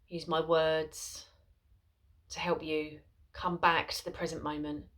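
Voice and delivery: soft, unhurried voice with long pauses; guided meditation. Acted. Mindfulness teacher guiding followers into a seated body scan, soft guided meditation. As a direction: settle listeners gently into stillness, softly and spaciously.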